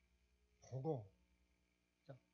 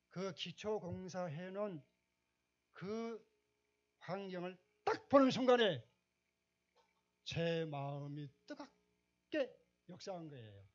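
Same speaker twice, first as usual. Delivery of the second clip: higher, louder, slowed preaching build; fervent preaching; 5 semitones higher, 10 dB louder, slower than usual. Acted. Pastor telling the congregation how the vision moved him, fervent. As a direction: preach it out, swelling with conviction.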